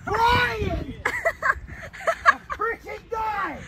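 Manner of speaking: high, slow anguished yelling; pained scream. Real, not acted. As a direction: scream out in burning pain. Screaming from chip pain.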